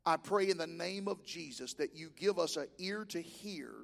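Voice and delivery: low, soft prayer voice; a hushed closing prayer. Real, not acted. Preacher praying before the sermon, low and hushed.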